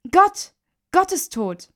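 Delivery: high, loud outburst, then sinking; breathless shock. A narrator's reading. Woman answering who has died, breathless and shaken. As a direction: gasp out the terrible news. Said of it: "stolperte schwer atmend herein".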